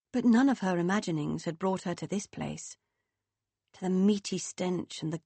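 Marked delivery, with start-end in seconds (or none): none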